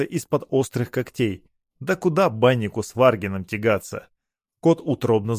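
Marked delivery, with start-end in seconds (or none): none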